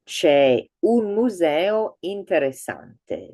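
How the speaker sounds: C'è un museo interessante said slowly and clearly; slow model sentence. Shown as a demonstration. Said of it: "you can say".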